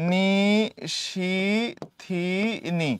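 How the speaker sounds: each syllable alone, a pause after it; syllable-by-syllable dictation. Shown as a demonstration. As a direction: spell it out syllable by syllable, unhurried.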